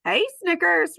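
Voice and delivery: put-on a cheerful little kid greeting; young elephant. Ruby greeting Snickers, bright child voice.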